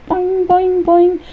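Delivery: high, springy boings; bouncing cartoon sound. Happy smiley jumping around, high bouncing boings. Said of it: "he starts jumping around".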